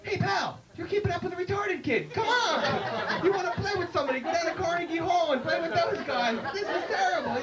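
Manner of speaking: high, indignant scolding; mock outraged heckle. Scolding the guitar player for picking on the kid, high. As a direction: jab at the show-off.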